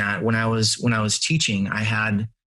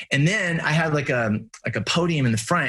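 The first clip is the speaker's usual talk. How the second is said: ordinary delivery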